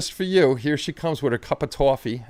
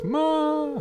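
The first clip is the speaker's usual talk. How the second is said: high, drawn-out call for mom; kid yelling upstairs. Retold or imitated. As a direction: imitate a kid calling up the stairs. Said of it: "like as kids yell up the stairs".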